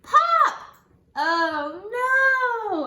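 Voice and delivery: high, loud pop and oh no; hammed-up alarm. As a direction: burst out with the pop. Button pops in the story.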